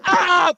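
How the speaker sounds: high mock child wail; high-pitched kid's cry. Imitating himself at seven, high-pitched cry. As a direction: yelp "ah" up high like a little kid. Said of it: "in, like, a high-pitched voice".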